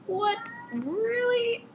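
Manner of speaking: high-pitched mock disbelief; incredulous. Reader reacting to his wanting her to meet mom, incredulous. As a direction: squeal it in exaggerated disbelief.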